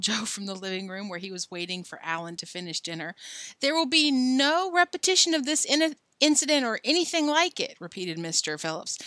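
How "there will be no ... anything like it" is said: stern. A narrator's reading. Father firmly scolding son.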